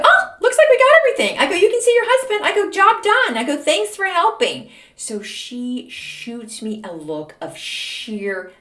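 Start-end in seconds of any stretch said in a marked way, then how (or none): 0.0-4.7 very high, loud, sugary victory chirp; fake triumphant cheer. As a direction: rub it in with syrupy cheer.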